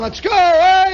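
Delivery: high, full-voiced cheering shout; crowd yelling. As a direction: holler like an excited spectator. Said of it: "the people are yelling".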